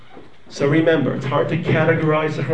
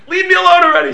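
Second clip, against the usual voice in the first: put-on annoyed child; 12 semitones higher, 9 dB louder, faster than usual.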